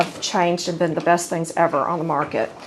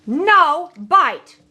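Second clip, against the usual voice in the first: firm command; 7 semitones higher, 4 dB louder than usual. Shown as a demonstration. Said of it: "this is how it's done".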